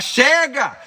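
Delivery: a sudden yell of Chega; shout. Shown as a demonstration. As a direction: cry out Chega to end it. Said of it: "Grita assim".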